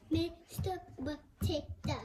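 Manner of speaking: "Mister Potato" in slow separate beats; broken into syllables. Shown as a demonstration. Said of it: "Four syllables".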